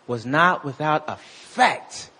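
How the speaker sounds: slowed, weighing each word; slow emphatic repeat. Shown as a demonstration. Preacher repeating the verse to the congregation, slow and weighty. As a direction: lean on grace, slowly. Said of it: "He said".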